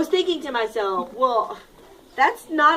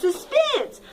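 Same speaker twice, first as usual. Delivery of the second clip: much higher, emphatic correction; correcting; 11 semitones higher than usual. Shown as a demonstration. Teacher corrects the ants, much higher on Suspense. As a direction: correct the word with a lift. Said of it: "correcting the word".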